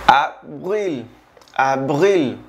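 Abril sounded like ah-vreel; French pronunciation. Shown as a demonstration. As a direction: avril à la française. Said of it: "se pronuncia abril".

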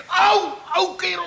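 high pained yelps. Acted. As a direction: cry out as the arm is twisted. Butler twists his arm.

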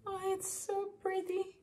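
high, thrilled squeal of delight; excited squeal. Real, not acted. To her viewers, gushing over the new bag, a high squeal. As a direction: squeal it like you can't contain yourself.

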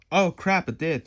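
high, loud burst of surprise, easing off; retold startled realization. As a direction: blurt it out, suddenly alarmed. Retells his startled reaction to friend.

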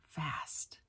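breathy whispered repeat of fast; whispered echo. Acted. Reading aloud to kids, whispering the echoed word.

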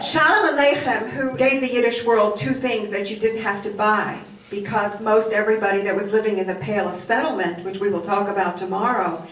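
usual speaking voice

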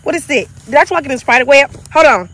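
loud, fast, frightened outburst; panicked. Real, not acted. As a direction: blurt it out in a panic. Walked into spider web, panicking.

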